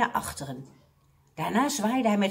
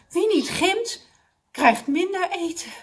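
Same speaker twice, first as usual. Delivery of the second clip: high, mildly puffed dismay; panting; 9 semitones higher, 4 dB louder than usual. A narrator's reading. Mr Abdul realising food is cut, panting. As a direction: exclaim it as if winded. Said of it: "zei meneer Abdul hijgend".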